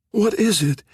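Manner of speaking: high, frail husky voice; barely there, sick woman's voice; weak husky whisper. A narrator's reading. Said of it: "asked a weak voice from behind Leader".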